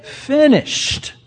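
high, ringing word; Jesus's word. Retold or imitated. Preacher quoting Jesus's last word, ringing high. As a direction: lift it high as a proclamation. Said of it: "how's that line go?".

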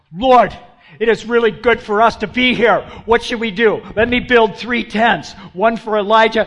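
nervous babbler at full volume; loud, high, over-eager gushing; hammed-up blurting to cover discomfort; Peter. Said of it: "goes on and talks like that".

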